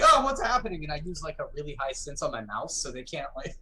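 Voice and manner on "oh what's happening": mock startled, much higher and louder; startled victim. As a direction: play the panicked enemy caught off guard. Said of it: "to where they're like".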